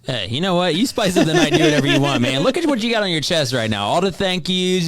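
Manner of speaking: high, animated teasing; playful hyping-up. Pretend. Co-host teases his buddy about Valentine's romance, playful hyping-up.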